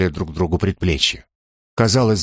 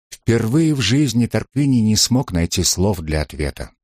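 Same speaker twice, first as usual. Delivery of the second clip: usual speaking voice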